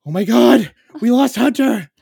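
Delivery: loud, high panicked cry; retold panic. Dad discovering his son is lost, panicked. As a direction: cry it out in dawning horror.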